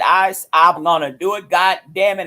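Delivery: normal talking voice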